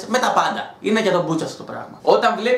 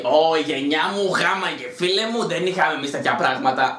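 mock old-timer; 5 semitones lower than usual. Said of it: "να είμαι σε φάση".

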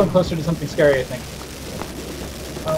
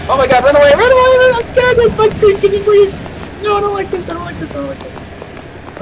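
panicked; 13 semitones higher than usual. Real, not acted. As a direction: shriek in real panic, fleeing. Panicking in horror game.

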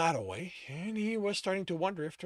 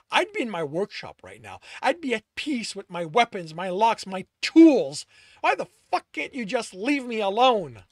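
louder and higher, pleading rage; angry shout; 6 semitones higher, 12 dB louder than usual. A narrator's reading. Tristan shouts at Alex to leave, furious. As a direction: shout it out, near breaking.

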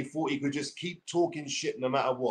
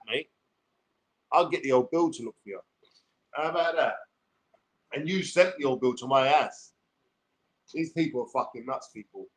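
normal talking voice

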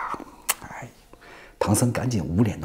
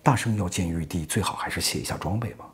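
low, soft hesitant request; reluctant, quieter suggestion; slightly awkward; 4 semitones lower, 6 dB quieter than usual. A narrator's reading. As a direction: ask it delicately, voice dropped. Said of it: "略有难色".

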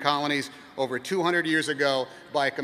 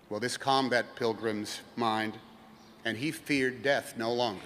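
ordinary delivery

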